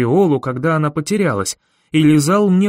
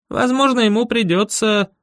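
put-on higher, even boy's voice; boy character; 7 semitones higher than usual. A narrator's reading. Boy speculating to the narrator in the same tone, higher. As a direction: keep the boy's voice pitched up. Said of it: "сказал он тем же тоном".

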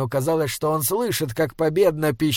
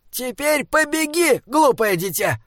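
high, urgent troll cry; high-pitched, exasperated command; cried order; 9 semitones higher than usual. A narrator's reading. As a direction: call out high, hurrying the child along. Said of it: "крикнул Бинобик".

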